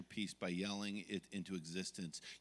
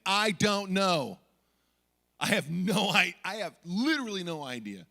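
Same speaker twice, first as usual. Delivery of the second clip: exasperated yell; 6 semitones higher, 11 dB louder than usual. Retold or imitated. Dad answering teacher about his crying daughter, exasperated yell. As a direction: yell it like a parent at wits' end.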